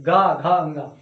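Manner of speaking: low buzzing गा, घा, अंगा; voiced resonance demo. Shown as a demonstration. Teacher contrasting voiced गा, घा, अंगा. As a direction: hum through गा घा अंगा. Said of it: "का, खा बहुत आराम से बोलते हैं".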